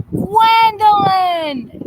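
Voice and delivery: stretched out, high and loud, calling her name; excited greeting. Real, not acted. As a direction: greet her with big delight, slowly drawn out.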